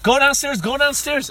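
high, insistent mom voice; mock eager urging. Friend's mom sends him down, high mock urging.

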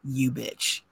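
low, hushed mock reproach; quiet, knowing dig; muttered accusation. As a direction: mutter it under your breath at a friend. Acting out silent look at friend.

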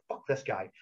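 low, soft retold aside; muttered contempt. Sickened wrestler about his promoter, retold muttered contempt. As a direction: mutter it as a private thought. Retells silent disgust at the boss.

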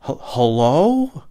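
slow, hesitant, rising hello; nervous hello. Retold or imitated. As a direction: answer like you're scared who's calling. Answering phone cold again.

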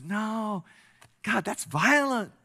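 protesting. Acted. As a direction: balk at the order, voice jumping up. Man protests to God's command.

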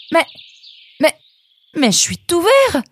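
cri aigu et fort de surprise; stupeur. A narrator's reading. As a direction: hurler sa stupeur, très haut. Nemrod discovers he is green. (jeune renard découvre qu'il est vert, cri de stupeur)

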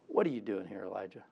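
low, soft, gentle question; still small voice. Acted. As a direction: ask it hushed like the quiet voice of God. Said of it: "a voice came to him and said".

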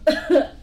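evil queen cackle, loud; a loud wicked laugh; bursting cackle of glee; gleeful cackle. Acted. Queen gloating in story.

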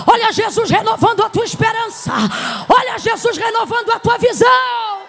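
loud, driving preacher's cry; fervent revival preaching, loud and rising; full-force preaching. Acted. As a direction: preach it out to the whole hall, building.

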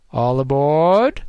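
drawn-out tannoy announcement; amplified announcer. A narrator's reading. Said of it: "repeated the announcer".

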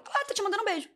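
put-on high-pitched voice for the boy messenger; imitated boy. Boy passing her father's message on, in high-pitched imitation. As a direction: lightly mimic the boy, lifting the pitch high.

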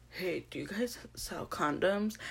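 slow, whispered sheepish question; hushed customer. Retold or imitated. Customer asking the shop clerk for condoms, mock furtive whisper. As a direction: whisper it like an embarrassed shopper.

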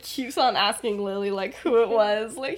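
ordinary talk, nothing marked

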